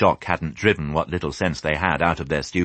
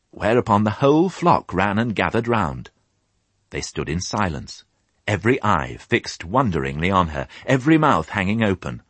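ordinary delivery, nothing marked

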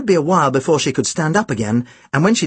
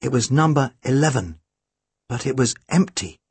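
ordinary delivery, nothing marked

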